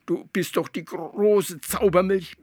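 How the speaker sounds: high frog voice begging; pleading. Acted. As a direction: beg the great Zaubermilch. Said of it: "flehte Atze". (Atze fleht die Zaubermilch an, hoch und bittend)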